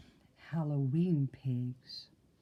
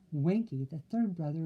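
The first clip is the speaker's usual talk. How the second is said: usual speaking voice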